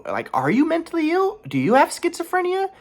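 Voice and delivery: very high mock interrogation; disbelieving questions, rising way up high; imagined incredulous questioning. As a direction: mimic her suspicion with soaring pitch. Voices her imagined suspicious questions.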